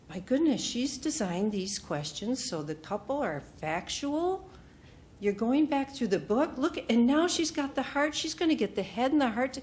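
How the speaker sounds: rising to a high awesome; excited. Retold or imitated. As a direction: gush over the materials. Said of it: "I was so excited".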